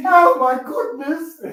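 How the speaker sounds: high, drawn-out mock-British wail; hammed-up British crying voice; crying British accent. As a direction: imitate a tearful British accent, loud and slow. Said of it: "how you cry with a British accent?".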